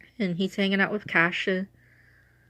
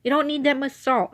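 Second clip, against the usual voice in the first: imitating mom scolding; 7 semitones higher than usual. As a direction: play mom nagging about the salt, pitched up. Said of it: "My mom again would yell at me".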